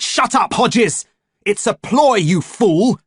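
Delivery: shouted warning to Hodges; angry interruption. A narrator's reading. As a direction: cut in furiously. Other voice interrupts angrily.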